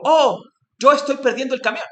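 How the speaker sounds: high, loud fussing over himself; overplayed "yo" worry; mock self-centered exclamation. Teacher acting the wrong way to react to missing the truck, high and loud. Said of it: "Decía, nunca digo".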